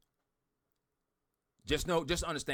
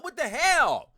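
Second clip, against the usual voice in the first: much higher and louder in disbelief; can't believe what he's reading; incredulous outburst; 11 semitones higher, 5 dB louder, much slower than usual. Real, not acted. Reacting to a badly worded post.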